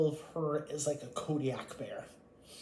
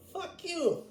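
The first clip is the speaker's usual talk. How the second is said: angry outburst; 3 dB louder than usual. Real, not acted. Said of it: "angry outburst at siblings".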